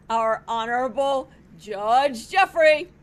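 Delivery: loud, slow, drawn-out introduction; grand announcement. Acted. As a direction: boom out the judge's name. Bailiff announces the judge.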